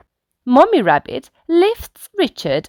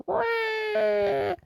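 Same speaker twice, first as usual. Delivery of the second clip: mock toddler cry; 9 dB quieter than usual. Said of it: "Richard cries".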